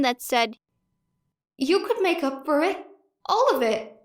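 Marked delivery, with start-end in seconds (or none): 1.6-3.9 higher, coaxing voice in her head; a small voice offering redemption; hopeful inner voice. A narrator's reading. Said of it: "hopeful little voice in the back of her mind".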